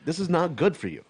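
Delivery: imitating mom. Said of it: "my mom's going".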